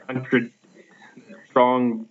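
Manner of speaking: normal talking voice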